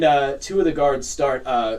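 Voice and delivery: ordinary talk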